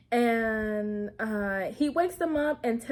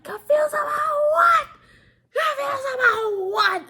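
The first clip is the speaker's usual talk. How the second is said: very high, loud incredulous shriek; hammed-up furious comeback; mock outraged shout; 8 dB louder than usual. Recapper voicing Ethan confronting Ari, mock outraged shout. Voices angry husband confronting cheating wife.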